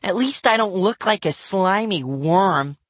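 put-on high, angry kid's retort; shouted insult. A narrator's reading. Livy hits back at Bradley, high angry shout. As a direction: fling the insult back at him. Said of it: "Livy shouted".

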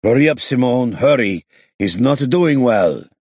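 high, loud, anxious call. Acted. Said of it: "called after him anxiously".

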